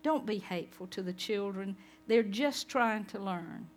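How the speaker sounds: put-on soft, tender plea for the children; gentle Jesus. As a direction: coax softly, like calling children close.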